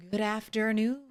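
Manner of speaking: usual speaking voice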